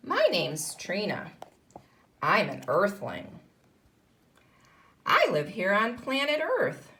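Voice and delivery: put-on lively read-aloud for young pupils; story reading. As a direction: read it like a picture book to a class. Teacher reads picture book aloud.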